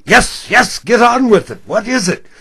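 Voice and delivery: loud, high, pushy yes yes; impatient yell. Retold or imitated. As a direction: hurry the old caller along, loudly. Impatient host rushing the caller.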